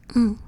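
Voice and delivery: Mm, the isolated NG sound; NG sound demo. Shown as a demonstration. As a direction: hum the NG consonant once, firmly. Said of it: "That's the sound it makes".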